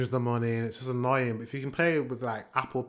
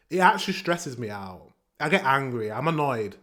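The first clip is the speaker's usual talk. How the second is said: ordinary talk, nothing marked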